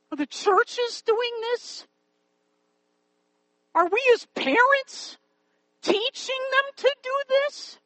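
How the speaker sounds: high-pitched, urgent rhetorical questions; impassioned preaching. Real, not acted. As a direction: challenge the congregation with pleading exasperation. Challenges parents and churches.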